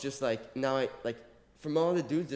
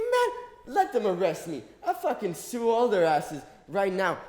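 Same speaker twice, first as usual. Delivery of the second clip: defiant shout; 6 semitones higher, 4 dB louder, slower than usual. Retold or imitated. Detainee shouting back at speaker.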